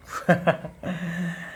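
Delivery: short low chuckle; real, brief laugh; amused laugh. Real, not acted. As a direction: chuckle at a silly comment. Amused by a viewer's comment.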